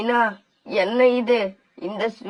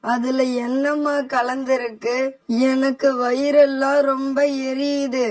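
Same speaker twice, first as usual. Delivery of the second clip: higher, strained voice of a man in agony; poisoned and in pain; 3 semitones higher than usual. Acted. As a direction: push the words out through the burning pain. Poisoned son answers his mother. (வலியில் துடிக்கும் மகனின் உயர்ந்த குரல்)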